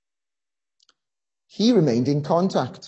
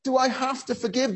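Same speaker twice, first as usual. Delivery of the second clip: inward scream; 9 semitones higher than usual. Retold or imitated. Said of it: "screaming, not outwardly, but inwardly".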